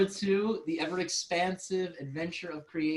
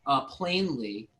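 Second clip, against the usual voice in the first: ordinary talk, nothing marked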